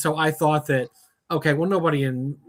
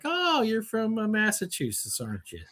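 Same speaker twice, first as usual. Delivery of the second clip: imitated guessing; 5 semitones higher, 6 dB quieter than usual. Southerner placing a northerner, imitated realization. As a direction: act out spotting an outsider.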